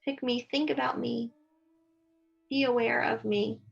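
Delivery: calm, even meditation guide voice; steady soothing meditation narration; guided meditation. Acted. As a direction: lead them gently into stillness.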